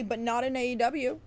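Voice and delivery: normal talking voice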